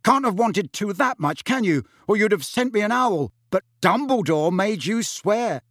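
high, sharp sneering retort; bitter retort. A narrator's reading. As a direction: throw it back at her bitterly. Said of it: "his fury".

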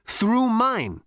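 brash, swaggering space rogue; cocky Zaphod. A narrator's reading. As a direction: sneer it at the robot. Zaphod's character voice.

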